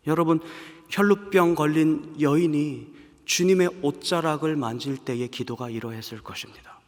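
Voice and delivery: normal talking voice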